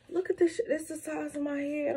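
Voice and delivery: amazed. Real, not acted. Showing huge food portion.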